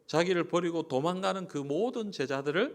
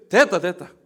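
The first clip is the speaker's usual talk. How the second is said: curt brush-off; 4 semitones higher, 13 dB louder than usual. Retold or imitated. Mimicking boss cutting someone off.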